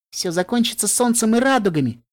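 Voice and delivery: bright, high, bubbly announcement; cheerful announcement. A narrator's reading. Pinkie Pie cheerfully promising sunshine and rainbows, high and happy. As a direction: sing it out with glee. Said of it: "объявила она радостно".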